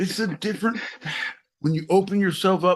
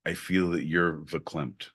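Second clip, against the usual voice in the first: mock soothing; 13 semitones lower, 4 dB quieter than usual. Soothing partner, shh.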